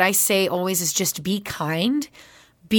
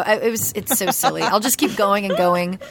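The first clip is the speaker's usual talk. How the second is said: normal talking voice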